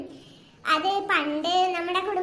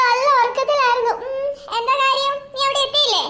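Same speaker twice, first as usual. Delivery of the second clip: loud, higher annoyed reply; loud groggy answer; 5 semitones higher, 6 dB louder than usual. Acted. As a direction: bark it down the phone. Woken friend on phone.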